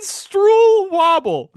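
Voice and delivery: high, loud and very slow; mock baffled old lady. Imitating his seatmate sounding out the snack name, high and drawn out. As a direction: stretch the mangled word out in wonder.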